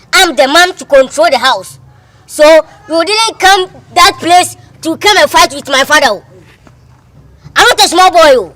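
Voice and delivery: indignant, forceful quarrelling; angry assertion. Acted. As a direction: assert your authority loudly. Angrily asserting himself in quarrel.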